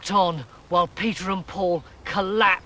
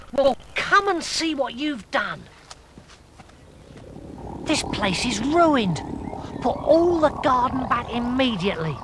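stern telling-off; 7 semitones higher than usual. Acted. Sylv scolds the toads.

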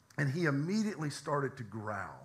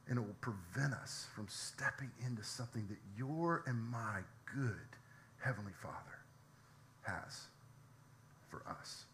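quiet reflective preaching; 6 dB quieter than usual. Acted. Pastor closing his sermon to the congregation, softly and reflectively.

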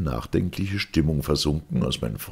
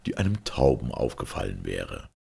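ordinary delivery

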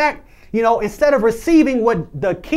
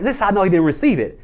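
usual speaking voice

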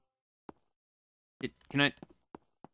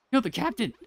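panicked shriek; 10 semitones higher, 3 dB louder than usual. Acted. Panicked repeat.

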